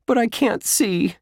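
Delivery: put-on very high boyish whine; complaining. A narrator's reading. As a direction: complain like an upset kid. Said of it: "Josh complained".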